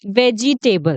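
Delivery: वेजिटेबल said "ve-jee-tay-bul"; wrong four-beat "vegetable". Shown as a demonstration. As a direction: sound every syllable of वेजिटेबल, with "jee". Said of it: "बहुत सारे दोस्त बोलते हैं".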